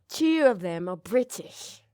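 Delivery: badly faked British accent, slightly slow; terrible British accent. Shown as a demonstration. Host jokes about the show's British cast, terrible British accent. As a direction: put on a poor British accent. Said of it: "That's a terrible accent".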